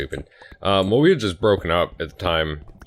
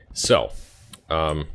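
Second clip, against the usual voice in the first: ordinary talk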